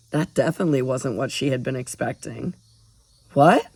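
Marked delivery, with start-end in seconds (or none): 3.3-3.7 blurted. A narrator's reading. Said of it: "Ari blurted out".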